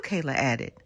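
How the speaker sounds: ordinary delivery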